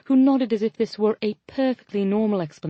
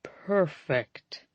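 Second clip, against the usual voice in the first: lower, softer, forcing cheer through a grimace; strained forced smile; 4 semitones lower, 4 dB quieter than usual. A narrator's reading. Jensen forcing a smile through a grimace, strained. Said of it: "smile through a grimace".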